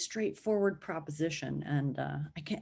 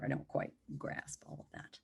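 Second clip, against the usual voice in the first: whispered aside; 12 dB quieter than usual. Real, not acted. Admitting confusion in an aside.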